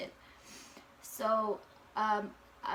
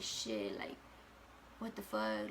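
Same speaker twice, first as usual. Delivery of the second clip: muttered curse; 13 semitones lower, 9 dB quieter, slower than usual. Retold or imitated. Alone, reacting to faint test line.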